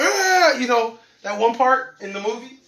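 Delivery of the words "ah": sudden high mock scream of fright; a short hammed-up startled scream. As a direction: yelp it like the movie scene. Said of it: "he's like".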